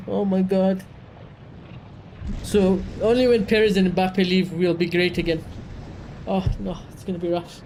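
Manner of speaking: ordinary delivery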